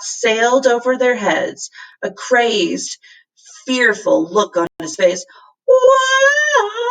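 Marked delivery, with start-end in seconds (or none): 5.7-6.8 very high, loud panicked cat cry; panicked shriek. A narrator's reading. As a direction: shriek as you fly over the edge. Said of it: "he shrieked".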